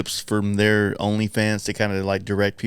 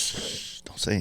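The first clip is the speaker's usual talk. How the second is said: mock hush; 11 dB quieter, slower than usual. Mock fear of Chuck Norris.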